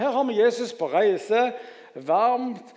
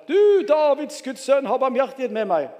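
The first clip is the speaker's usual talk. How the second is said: louder crying out; 5 semitones higher, 7 dB louder than usual. A narrator's reading. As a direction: read it as an insistent shout for mercy. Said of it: "bare enda høyere".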